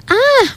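mild, high-pitched startled shriek. A narrator's reading. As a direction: yelp in fright. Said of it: "R J shrieked".

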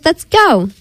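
high, eager storybook call; mild excited shout. A narrator's reading. Friends rush out to play, high mild shout. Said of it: "shouted the others".

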